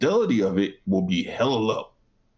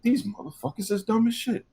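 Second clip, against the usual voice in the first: mock disbelief; 12 semitones higher than usual. Imitating his own disbelief.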